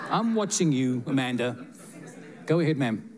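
usual speaking voice

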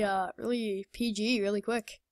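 ordinary delivery

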